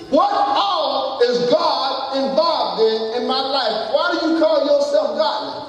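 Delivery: high, drawn-out sermon questioning; challenging preacher. Real, not acted. Preaches at full force.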